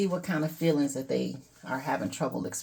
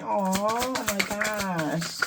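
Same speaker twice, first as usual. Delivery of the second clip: mock emotional; 5 semitones higher, much slower than usual. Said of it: "sometimes I get a little emotional reading these".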